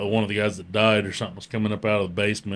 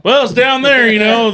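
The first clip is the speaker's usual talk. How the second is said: high, loud mocking coworker; mock send-off; 13 semitones higher, 10 dB louder than usual. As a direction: imitate a coworker pointing the new guy onward. Mock coworkers sending him to job.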